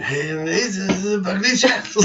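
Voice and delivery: slurred, slow pirate impression; hammed-up pirate, breaking into laughter after; Johnny Depp. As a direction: drawl it like a tipsy film pirate. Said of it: "imagine Johnny Depp saying".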